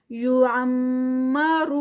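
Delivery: slow, drawn-out tajweed recitation; Quranic recitation. Shown as a demonstration. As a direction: chant it slowly, stretching each sound. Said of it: "را heavy". (آہستہ کھینچ کر تلاوت)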